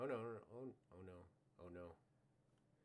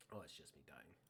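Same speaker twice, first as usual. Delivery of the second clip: ordinary talk, nothing marked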